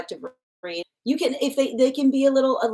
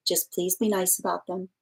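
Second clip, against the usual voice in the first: ordinary talk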